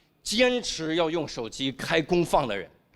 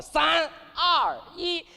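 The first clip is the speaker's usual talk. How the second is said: high countdown shout; countdown announcer; 12 semitones higher, much slower than usual. Acted. Imaginary delivery-room timer announcing fatherhood, high shouted countdown. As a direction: count down high, then cry out the news. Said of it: "倒计时".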